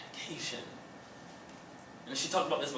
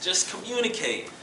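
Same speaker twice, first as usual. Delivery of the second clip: ordinary talk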